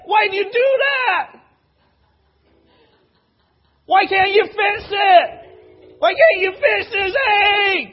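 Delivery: preacher mocking a panicked outburst, hammed-up shouting; mock tantrum. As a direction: throw a pretend fit over a broken egg. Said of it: "that's how we could sound".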